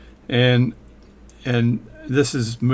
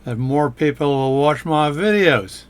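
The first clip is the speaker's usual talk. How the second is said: put-on Australian accent, louder; playful fake Australian accent, projected; 3 semitones higher, 4 dB louder than usual. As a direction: lay on a mock Aussie accent. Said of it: "speak with an Australian accent".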